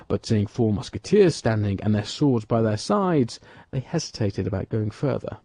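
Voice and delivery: ordinary delivery, nothing marked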